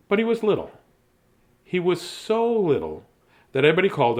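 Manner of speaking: storybook voice; story narration. Acted. Reads the story aloud.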